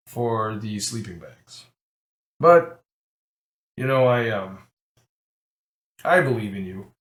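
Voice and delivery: a Goliath rumbling low with blunt kindness; deep gruff giant. Acted. Giant rancher turning down the blind man, lightly voiced deep and dry. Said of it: "sounds huge with a deep voice that's kinda gruff".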